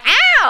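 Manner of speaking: high, loud mock yelp; imitating a yelping child; kid's ow. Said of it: "they're like".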